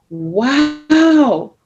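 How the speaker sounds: amazed wow. Acted. Fascinated children react to the story, loud and amazed. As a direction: gasp it out like a thrilled class. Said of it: "They said in unison".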